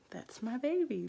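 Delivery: put-on growing excitement over the baby; rising joy. Mother first sees newborn daughter, rising joy. New mom meeting her baby.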